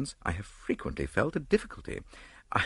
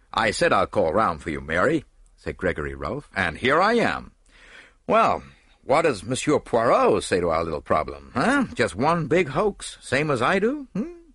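loud, confident actor's voice; breezy, overbearing husband; hearty big actor; 2 semitones higher, 5 dB louder than usual. A narrator's reading. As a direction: boom it out like a cocksure leading man. Story figure, big actor.

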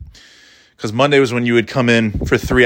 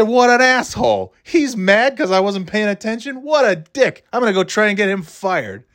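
high-pitched, indignant student rant; irate; 8 semitones higher than usual. Retold or imitated. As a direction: fume like an outraged teenager retelling a slight. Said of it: "I was, I was irate".

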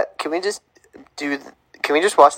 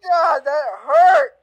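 mock pained shout; 11 semitones higher, much slower than usual. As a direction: wail as if the word hurt. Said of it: "Tommy's in pain because of your pronunciation".

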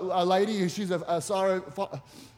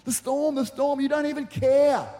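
mock panic, pitched up high; frantic cry to wake someone, acted out; higher-pitched, hammed-up alarm; freaking out; 6 semitones higher than usual. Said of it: "freak out".

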